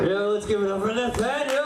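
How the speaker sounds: rally the crowd to applaud; host hyping applause. Real, not acted. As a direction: emcee send-off with a stretched final name.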